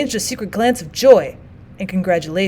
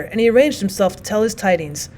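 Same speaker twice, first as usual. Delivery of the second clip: usual speaking voice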